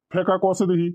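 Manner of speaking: normal talking voice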